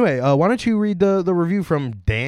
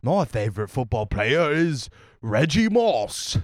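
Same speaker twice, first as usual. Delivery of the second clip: hammed-up British football fan; British guy proudly naming his player; changed British character voice. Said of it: "I changed his voice".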